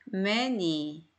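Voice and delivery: 매니 said slowly as mae-ni; teacher splitting many into syllables; slow split reading. Shown as a demonstration. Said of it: "잘라서 읽으면 돼요".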